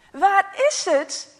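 urgent demand. Acted. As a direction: press him hard for an answer. Parents demand the book.

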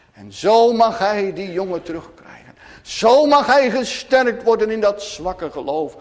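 ordinary talk

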